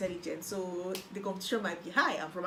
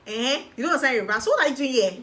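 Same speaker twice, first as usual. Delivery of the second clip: loud, higher, scornful Eh and questions; hostile office woman; 5 semitones higher, 9 dB louder, faster than usual. Retold or imitated. Office woman snapping at the new student, hostile. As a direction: mimic a rude official snapping at a stranger. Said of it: "she was so hostile to me".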